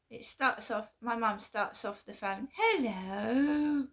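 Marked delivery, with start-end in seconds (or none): 2.5-3.9 mum's overly sweet hello, pitched up. Retold or imitated. Imitating mum answering the phone all sweet. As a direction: imitate mum's sugary greeting. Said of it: "like, all nice".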